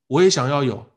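normal talking voice

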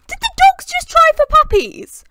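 high, loud, shocked outburst; shocked shout. Real, not acted. Gamer shouting at her dogs in total shock. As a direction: blurt it out in disbelief.

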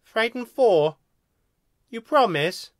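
high, hopeful alien question; sniffly hope. A narrator's reading. Second alien seeking promise of holiday, sniffly. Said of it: "The second alien sniffed".

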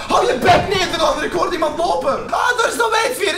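fighting the game over the phone, a rattled shout; startled shout. Real, not acted. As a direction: shout him down, half laughing. Shouting at the caller, scared.

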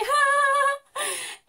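high cackling laugh; laughing hard at her own punchline; cackle. Real, not acted. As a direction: burst out cackling. Laughing at joke.

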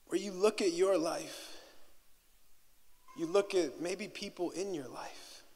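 ordinary talk

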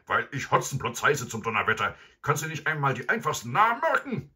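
loud, high furious robber; angry shout. A narrator's reading. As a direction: roar it at him. Robber yelling.

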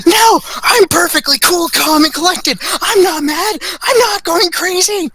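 high, strained voice, insisting he's calm; furious fake calm. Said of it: "And he goes".

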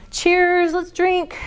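ordinary delivery

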